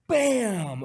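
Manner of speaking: loud high bam; loud punchy bam; imitated impact. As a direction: smack the word like a hit.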